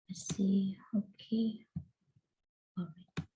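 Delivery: whispering to herself while checking notes; a quick unvoiced aside under her breath; whispered aside. Real, not acted. Lecturer whispering to herself mid-lesson, hushed.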